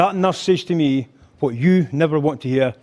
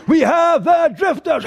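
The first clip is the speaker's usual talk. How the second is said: high, drawn-out big introduction; mock emcee's climactic shout; show announcer; 12 semitones higher, 3 dB louder, slower than usual. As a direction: slowly call out the act at the top of your range.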